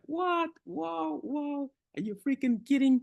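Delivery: squealing high, stunned "whoa, whoa"; shocked disbelief. Retold or imitated. Coach imitating a shocked winner's disbelieving squeal. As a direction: play it like you can't believe the news. Mock reaction to a big win.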